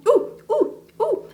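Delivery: high, loud delighted ooh; excited oohs. Real, not acted. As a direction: coo over it. Excited cooing.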